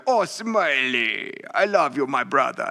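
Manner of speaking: imitated big Turkish friend, drawn-out and affectionate; Veli. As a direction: stretch the greeting out slowly, like a big fond friend. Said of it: "he talked really low".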